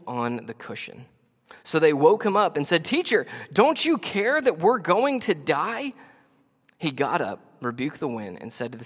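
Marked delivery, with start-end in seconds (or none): 2.8-5.9 high, loud, drawn-out protest; acted panicked appeal from scripture; alarmed plea. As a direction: read it as a desperate cry for help. Reads terrified disciples waking Jesus.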